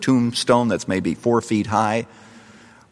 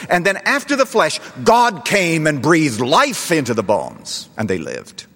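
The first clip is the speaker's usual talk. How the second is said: impassioned preaching; 3 semitones higher than usual. Acted. Preacher telling the congregation about the dry bones, impassioned. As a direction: preach it with rising force. Preaching builds to full force.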